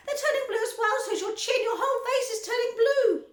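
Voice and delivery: high, loud frantic cry; panicked. A narrator's reading. Mother screaming.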